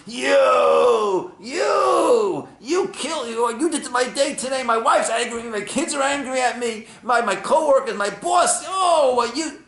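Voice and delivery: high, loud stammering outburst; furious shout. Acted. Driver yelling at the man who cut him off, furious. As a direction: explode at him after a ruined day.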